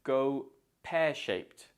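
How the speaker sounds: slow GO PEAR-SHAPED, ending in p plus t; final t sound. Shown as a demonstration. Teacher models for learners, slowly, SHAPED ending in t. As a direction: draw out GO PEAR-SHAPED, saying its ED as t. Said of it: "so the E D makes a t sound".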